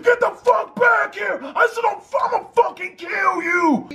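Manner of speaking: high, strained imitation of a chasing man's yell; mock distant yelling. Gunman chasing the brother down the street, strained mock yell.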